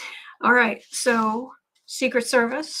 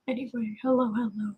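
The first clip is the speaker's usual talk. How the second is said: ordinary talk, nothing marked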